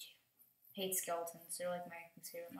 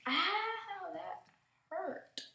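pained yelp; 11 semitones higher, 5 dB louder, much slower than usual. Real, not acted. Hit by a mob.

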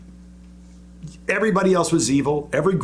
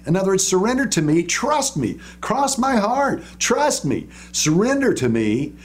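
mock politician's promise; 5 semitones higher than usual. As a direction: sell the surrender like a smooth politician. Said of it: "says every politician".

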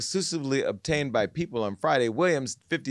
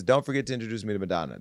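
fast, tossed-off reminder; quick request; 3 semitones lower, faster than usual. Retold or imitated. Host quoting Wendy's only request, said fast. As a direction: rattle it off quickly. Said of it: "The only thing she would say to me".

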